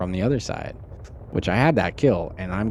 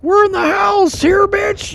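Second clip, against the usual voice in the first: higher, louder mock shout of a gloating enemy; mock taunting enemy; 8 dB louder, slower than usual. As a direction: yell it like a cocky villain taunting you. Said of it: "Alien's like".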